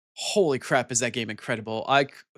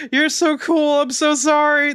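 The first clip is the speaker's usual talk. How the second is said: much higher and louder, hammed-up apology; frantic apology; 4 dB louder, slower than usual. Streamer apologizing to a timed-out viewer, frantic. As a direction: gush out an apology in mock panic. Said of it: "I'm like".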